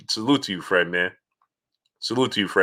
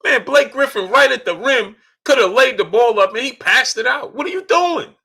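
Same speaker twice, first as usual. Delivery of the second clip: very high, incredulous fan rant; real emotional outburst. Real, not acted. Fan complaining about a missed layup, very high emotional outburst. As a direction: squeal in exasperation at the play. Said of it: "I get emotional about".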